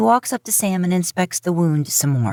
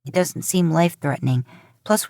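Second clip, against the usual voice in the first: plain reading voice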